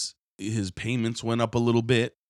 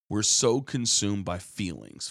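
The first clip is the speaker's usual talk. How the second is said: normal talking voice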